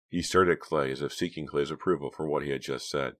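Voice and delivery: ordinary delivery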